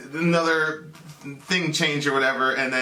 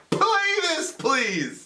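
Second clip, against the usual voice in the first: high, drawn plea falling on please; retold pleading; 12 semitones higher, much slower than usual. Looking at her without the camera, pleading. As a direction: beg in a pitched-up voice. Begging companion to film.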